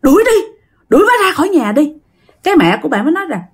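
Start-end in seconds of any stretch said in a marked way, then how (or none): 0.0-1.9 high, loud shouted order to throw her out; loud, fast, frantic shout; retold shouting. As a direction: yell it like a man gone strange. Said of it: "Mà ba làm kỳ lắm các bạn".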